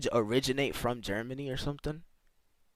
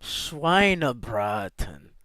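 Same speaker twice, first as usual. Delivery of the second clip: drawn-out, slow Schweinebraten; 2 semitones lower, much slower than usual. Real, not acted. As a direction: stretch out each syllable of Schweinebraten. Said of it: "I slowed down my voice".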